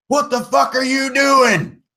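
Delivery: high, strained crackly shout. Acted. Crackly ship's computer voice shouting at the crew, high and harsh. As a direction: burst out like an angry voice over a crackly speaker. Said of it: "you hear a crackly voice".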